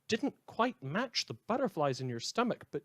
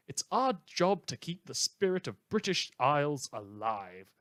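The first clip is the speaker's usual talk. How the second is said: ordinary delivery, nothing marked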